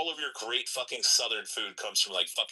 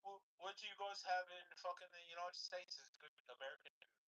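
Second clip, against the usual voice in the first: high, lightly put-on questioning voice; imitating young actor; 8 semitones higher, 19 dB quieter than usual. Man imitating Spider-Man actor quizzing Americans, light mockery. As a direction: mimic him in a raised voice. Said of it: "that fucking kid that plays fucking Spider-Man".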